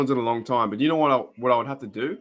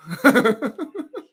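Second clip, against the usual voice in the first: high laugh; 12 semitones higher than usual. Real, not acted. Reacting to stream voice going off.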